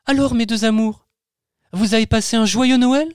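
high, gentle mother voice; tender mother. Acted. Mother kissing the children goodnight, high tender voice. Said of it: "maman embrasse tendrement".

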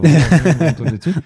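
words squeezed out through a laugh; slow, high, laughing as he says it; breaking into laughter mid-phrase. Real, not acted. Laughing at his own joke.

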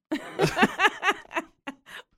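loud high giggling fit; high burst of laughter. Real, not acted. Laughs at own confession.

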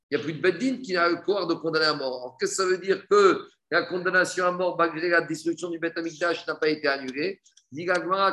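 normal talking voice

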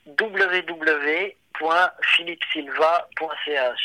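slow, careful dictation; dictating. Real, not acted. Giving the host his website address, slow and careful. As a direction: spell it out slowly for the listener.

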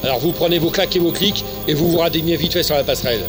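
furious officer barking orders; drill sergeant. Acted. Commandant engueule le sergent. (sergent instructeur furieux qui hurle)

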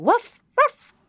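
high playful barking sound; dog bark imitation. Shown as a demonstration. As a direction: bark like a puppy for a toddler. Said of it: "Il fait".

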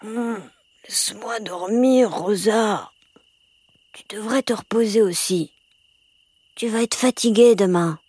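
put-on slow, drowsy little boy; half asleep, dragging the words; sleepy voice. A narrator's reading. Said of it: "répondit d'une voix endormie".

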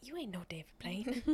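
breathy whispered jab, no voice at all; teasing whisper. Pretend. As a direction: whisper the put-down like a secret tease. Teasing aside about the magician.

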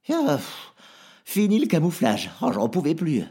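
a little creature glad to drop the disguise; relieved creature voice. Acted. Zladi fatigué de se cacher. (petite créature aiguë, soulagée)

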